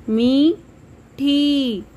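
मीठी drawn out with a held मी; slow stretched long ई. Shown as a demonstration. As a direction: sound out मीठी slowly. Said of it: "आवाज़ थोड़ी सी लंबी". (मीठी को धीरे खींचकर)

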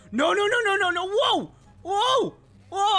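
high, rapid-fire no's into yelps; panicked gamer. Real, not acted. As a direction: yelp as it all goes out of control. Losing control in game.

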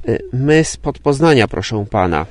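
ordinary delivery

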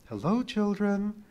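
high, slow motherly alien voice; gentle alien mother. A narrator's reading. Alien mother greets the children, high and slow.

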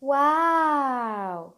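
very slow, stretched-out واو; amazed wow. Acted. Teacher reacts to the next word, drawn-out wow. Mock amazement between lesson items.

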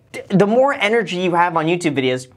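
ordinary talk

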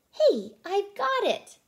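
high, loud and slow eureka cry; excited exclamation. A narrator's reading. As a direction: burst out with the idea, high and loud. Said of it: "Magenta exclaimed".

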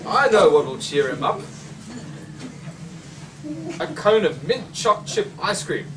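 plain reading voice

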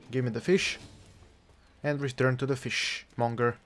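usual speaking voice

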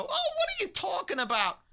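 high, fast clueless grandma squeal; out-of-it old mom. Retold or imitated. Mocking a clueless mom, high squeal. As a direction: play the confused old lady. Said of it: "She doesn't go".

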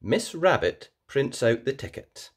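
usual speaking voice

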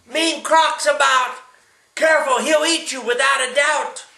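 loud, high shouted warning; urgent warning. Acted. Grandpa warns the animals the croc is about, shouting.

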